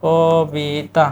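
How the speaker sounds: কবিতা drawn out very slowly; slow teacher demo; slow pronunciation demo. Shown as a demonstration. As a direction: stretch কবিতা for the class. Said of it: "পরবর্তী শব্দ কবিতা".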